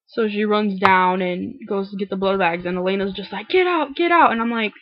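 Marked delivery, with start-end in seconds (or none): 3.5-4.3 high, loud urgent get out; frantic shout. Retold or imitated. Elena panicking and ordering people out, loud and high. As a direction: shove them out in a panic.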